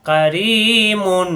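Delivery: কারিমুন with রি held long, slow recitation; stretched vowel. Shown as a demonstration. Teacher stretching কারিমুন slowly for students. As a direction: draw out the রি in কারিমুন. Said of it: "রিকে লম্বা করতে হবে".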